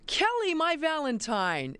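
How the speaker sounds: high, slow, drawn-out playful call; playful greeting. Retold or imitated. Referee greets her old nemesis coach, high and playfully drawn out. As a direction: sing out the name like a sweetheart's greeting. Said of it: "I just go".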